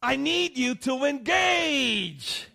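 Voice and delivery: high, loud, slow scream; mock-frantic cry for help; screaming mother. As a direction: yell it like a fed-up mom. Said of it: "she screams at her husband".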